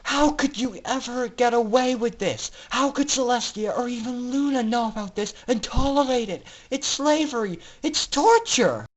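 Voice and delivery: high, loud furious outcry; angry yell. Acted. Pink pony yells at the announcer, furious. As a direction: yell it in outrage. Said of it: "then yelled".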